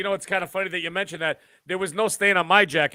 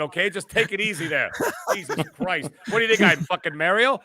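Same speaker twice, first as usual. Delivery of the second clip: ordinary talk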